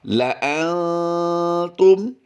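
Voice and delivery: drawn-out chanted recitation, pitched high; slow melodic Quran recitation; Quranic recitation. Shown as a demonstration. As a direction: recite it slowly like tajweed. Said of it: "Quran recitation demo".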